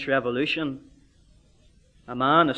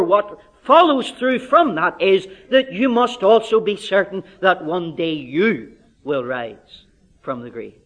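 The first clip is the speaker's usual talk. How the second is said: normal talking voice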